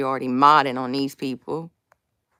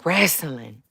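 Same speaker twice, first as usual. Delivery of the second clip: ordinary talk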